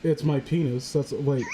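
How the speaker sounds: usual speaking voice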